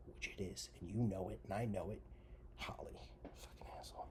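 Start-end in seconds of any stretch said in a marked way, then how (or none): 2.6-4.1 soft hissed aside; whispered aside; whispered insult. Real, not acted. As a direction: mutter it under your breath.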